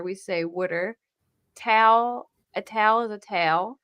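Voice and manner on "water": wooder for water, wu-der; Philly wooder. Shown as a demonstration. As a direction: say wooder with the vowel of wood. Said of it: "instead of water".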